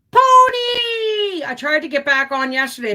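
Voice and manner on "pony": high, loud shout of a name; excited call. Real, not acted. Greeting a viewer by calling out.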